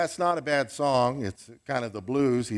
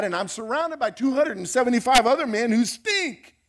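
Paul ranting; 7 semitones higher than usual. Pretend. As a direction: whine to God like a fed-up prisoner. Preacher voicing Paul's imagined rant.

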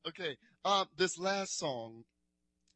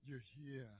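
much softer, almost under the breath; hushed repeat; 4 semitones lower, 17 dB quieter than usual. Shown as a demonstration. Preacher hushing the words to the congregation. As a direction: let it drop to a hush. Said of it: "preacher repeating to congregation".

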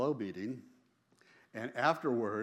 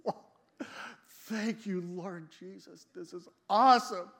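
much higher, amazed cry of thanks; awestruck exclamation; 9 semitones higher, 7 dB louder than usual. Retold or imitated. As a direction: gasp it out like you can't believe what you're seeing. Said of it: "and I'm going".

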